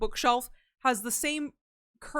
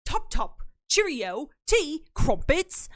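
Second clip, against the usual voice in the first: a lightly hammed-up British accent, word by word; mock British; 4 semitones higher, 3 dB louder, much slower than usual. To chat, pretending to become British, in a mock British accent. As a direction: toss off British clichés in a half-hearted posh British accent. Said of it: "I'm sorry for whatever I just did with my mouth".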